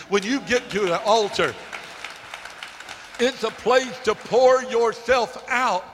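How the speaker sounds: rising pulpit fervor; fervent preaching. Acted. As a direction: lift the voice and preach it out. Preaching at full force.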